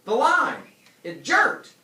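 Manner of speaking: high, loud startled shout; excited shout. Acted. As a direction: yell it out in alarm. Calvin startled.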